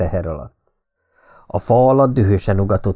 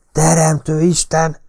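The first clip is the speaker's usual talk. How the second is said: panting exclamation; 8 semitones higher, 3 dB louder than usual. A narrator's reading. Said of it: "lihegte Mertens".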